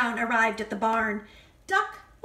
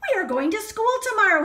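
higher, fast and louder; excited announcement; 7 semitones higher, 5 dB louder, much faster than usual. Acted. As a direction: announce it eagerly to the pigs. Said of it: "he announced".

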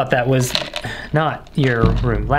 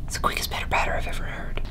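whispering; 8 dB quieter, faster than usual. Real, not acted. Hiding while grandpa approaches.

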